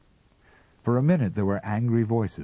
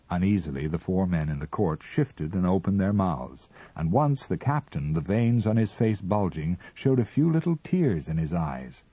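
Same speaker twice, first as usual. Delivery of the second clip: ordinary delivery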